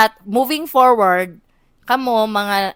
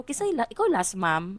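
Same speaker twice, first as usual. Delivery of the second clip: customer service voice; 4 semitones lower, 10 dB quieter than usual. Retold or imitated. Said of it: "That's your customer service voice?".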